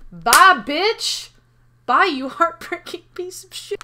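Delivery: loud, high taunting send-off; taunting farewell. Acted. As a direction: crow it in triumph. Gleeful send-off in game scene.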